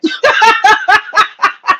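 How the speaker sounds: a short high breathy laugh; high nervous laugh. Real, not acted. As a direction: laugh off the rising anger. Nervous laugh after getting upset.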